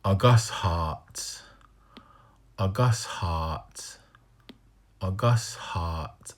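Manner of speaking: A Gus Hart said slowly; slow reading demo. Shown as a demonstration. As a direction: sound out A Gus Hart with care. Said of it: "I'm messing up right here".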